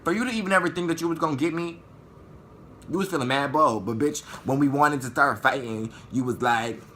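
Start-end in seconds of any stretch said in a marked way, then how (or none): none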